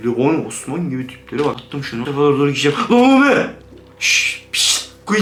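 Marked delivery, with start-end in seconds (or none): 4.0-5.2 a hushed hiss to silence someone; shushing whisper. Retold or imitated. Playing horror game, voicing the shushing sound in a whisper. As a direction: whisper it like the game telling you to hush. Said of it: "Sus diyor".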